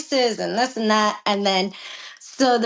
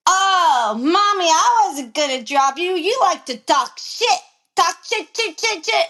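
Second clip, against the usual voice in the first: lightly mocking, higher-pitched whine; mocking imitation; 5 semitones higher than usual. Jockey imitating her rival after the race, high mocking taunt. Mimicking the other rider mockingly.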